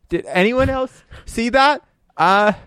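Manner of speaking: high, drawn-out incredulous question; bewildered, checking with anyone around; baffled disbelief. Acted. Character baffled by vanishing prankster.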